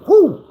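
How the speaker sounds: short high whoop, loud; whoo while swerving. Retold or imitated. Swinging the truck over, a loud high whoop. Swerving on the dark mountain road.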